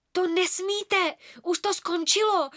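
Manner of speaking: put-on frightened boy insisting. A narrator's reading. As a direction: plead in fear. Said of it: "vystrašeně sledoval".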